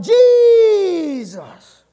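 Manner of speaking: loud, high, drawn-out cry for help; long pleading holler that falls away at the end; desperate shout. Acted. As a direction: shout after someone far ahead, holding the vowel. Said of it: "She shouted".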